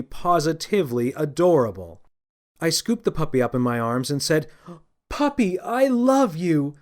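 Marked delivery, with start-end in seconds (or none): 5.1-6.7 high, slow gushing delight; slow ecstatic thanks; overjoyed. Acted. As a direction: gush with joy over the puppy. Overjoyed man hugs puppy.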